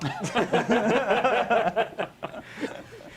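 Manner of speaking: long, loud, high-pitched laugh; real helpless laughing, rising high; burst of laughter. Real, not acted. Host amused that the Enzo still seems new, bursting into laughter. Laughing at own old-car remark.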